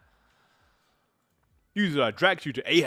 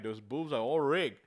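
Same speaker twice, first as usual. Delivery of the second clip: usual speaking voice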